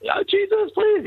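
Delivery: high-pitched panicked plea, imitated; mock desperate prayer. Soldier praying to Jesus while getting shot at, mock panic. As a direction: squeal a frantic plea to heaven in mock terror. Acts out a frightened foxhole prayer.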